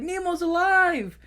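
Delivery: high, drawn-out cry of joy; Dory calling to the father, high and slow; joyful Dory. Retold or imitated. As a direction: announce the good news in delight. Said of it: "And Dory's like".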